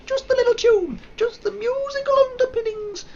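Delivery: tiny squeaky falsetto; comic falsetto afterthought; silly high voice. Real, not acted. Silly high voice echoing himself.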